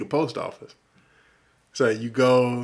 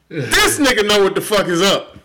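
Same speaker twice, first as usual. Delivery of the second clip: loud, high hyped-up exclamation; excited loud approval; 7 semitones higher, 8 dB louder than usual. Retold or imitated. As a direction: shout it like you just found your people. Retold inner excitement, loud.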